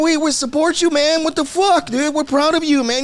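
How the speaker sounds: excited supporter. Retold or imitated. Chicano fans shouting support at the singer in a high excited voice. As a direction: cheer him on like a proud homeboy. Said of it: "Hey, we".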